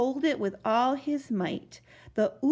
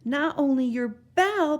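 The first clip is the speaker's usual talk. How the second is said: put-on louder, urgent boy's call; crying out; 5 semitones higher, 5 dB louder than usual. A narrator's reading. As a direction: cry it out to warn him. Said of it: "Bartholomew cried".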